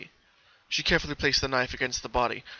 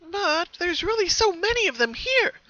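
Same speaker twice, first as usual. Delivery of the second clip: high, bright-toned cheerful pony voice; high cheerful cartoon pony; Pinkie Pie voice. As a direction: play the sweet pony with a sinister calm.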